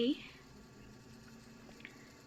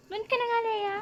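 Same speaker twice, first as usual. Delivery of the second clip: high exasperated shoo; 7 semitones higher, faster than usual. Acted. Yaya shoos the child away.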